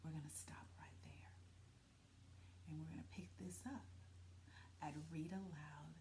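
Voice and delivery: soft teasing whisper; teacher whispers to the class that the story stops here. Real, not acted. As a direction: lean in and whisper the sign-off. Teacher hushes ending the reading.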